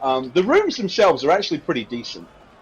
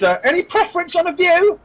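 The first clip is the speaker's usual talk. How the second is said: put-on very high-pitched serving girl; harried barmaid voice; put-upon barmaid. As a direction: ask the guests with thin patience. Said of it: "Finally, she says".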